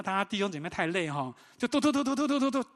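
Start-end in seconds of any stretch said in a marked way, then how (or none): 1.6-2.7 rapid high dudu. Retold or imitated. Teams finishing quickly.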